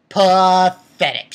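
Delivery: loud, drawn-out contempt; slow, loud, scornful verdict; emphatic scorn. Real, not acted. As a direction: stretch the word out slowly and loudly. Repeats whispered word loud, drawn out.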